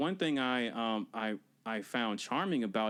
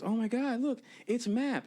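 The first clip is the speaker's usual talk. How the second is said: amazed tripping Dora; 11 semitones higher than usual. Retold or imitated. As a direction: marvel like you're seeing things. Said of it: "She goes".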